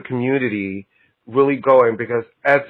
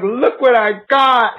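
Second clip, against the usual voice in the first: high, slow, thrilled cry; excited squeal; 9 semitones higher, slower than usual. Retold or imitated. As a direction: squeal it like a great find. Excitedly showing off a find.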